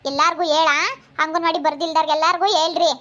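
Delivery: normal talking voice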